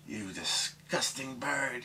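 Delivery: slow, high-pitched mock disgust; disgusted outrage. Woman berates her crude parrot, drawn-out disgust. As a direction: draw it out high as an appalled woman.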